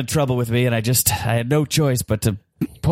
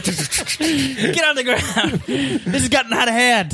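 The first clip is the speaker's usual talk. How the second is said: frantic shouting hostage taker; 9 semitones higher, much slower than usual. Acted. Hostage taker yelling, laughing.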